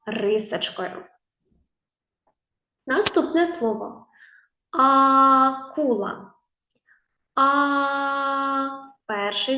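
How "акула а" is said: long sung-out "А" opening "Акула", repeated by itself; stretched first vowel. Shown as a demonstration. Teacher showing the first sound, drawn-out "А". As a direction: hold the "А" of "Акула" long and level, then "А" alone. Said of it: "Чи вміє він співати".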